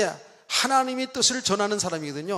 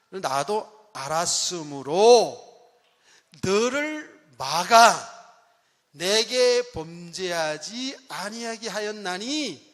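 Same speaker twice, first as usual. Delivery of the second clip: slow, solemn divine voice; God's voice; much slower than usual. Acted. God answering Abimelech in a dream, slow and solemn. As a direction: draw each word out like God speaking.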